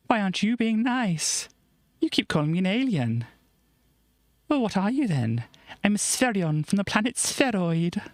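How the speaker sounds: character voices. Acted. Comic dialogue read aloud.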